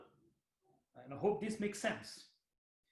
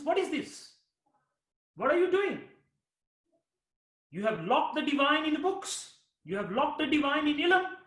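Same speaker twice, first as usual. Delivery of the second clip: challenging cry; 7 semitones higher, 5 dB louder than usual. Acted. Shams confronts Rumi.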